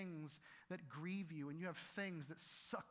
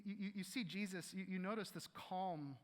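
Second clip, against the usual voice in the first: normal talking voice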